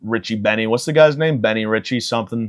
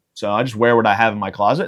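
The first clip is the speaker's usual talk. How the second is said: usual speaking voice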